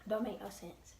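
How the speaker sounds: ordinary talk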